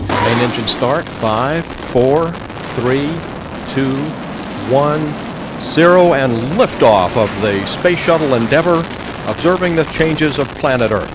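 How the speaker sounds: building excitement through liftoff; excited countdown. Real, not acted. Commentator calls the liftoff.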